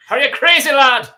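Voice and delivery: high, loud Irish brogue; Irish accent. Acted. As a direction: do a big hammy Irish accent. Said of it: "you gotta do the Irish".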